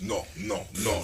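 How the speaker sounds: repeated mock French refusals; French accent. Gimmick character answers everything the same way, French accent. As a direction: lightly put on a French accent. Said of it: "He'd just do this French accent".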